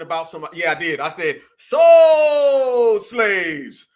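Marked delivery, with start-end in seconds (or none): 1.6-3.9 loud, long-drawn holler on "Sold"; big drawn-out shout, falling at the end; defiant proclamation. Retold or imitated. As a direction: belt it out, stretching the first word. Defiantly repeats own earlier statement.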